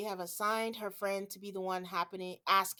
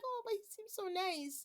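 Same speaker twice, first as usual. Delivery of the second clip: high, airy, won-over voice; high mock gushing; 11 semitones higher, 6 dB quieter than usual. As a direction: gush it like a fan. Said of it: "I was like".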